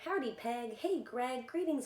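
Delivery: usual speaking voice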